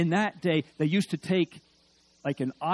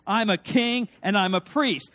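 much louder, confident call with the crowd; louder rallying declaration; 5 semitones higher, 7 dB louder than usual. Shown as a demonstration. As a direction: lift the whole room, proclaim it. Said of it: "Maybe one more time".

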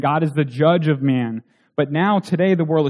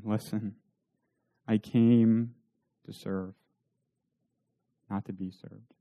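hushed words of Christ; 6 semitones lower, 10 dB quieter than usual. Acted. Christ's words voiced softly.

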